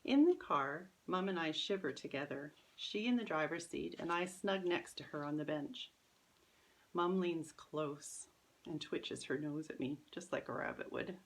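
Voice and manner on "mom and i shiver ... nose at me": plain reading voice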